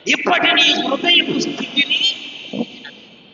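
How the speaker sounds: rising to a fervent preaching climax; preaching at full force. Acted. Preacher urging the congregation, fervent rising proclamation.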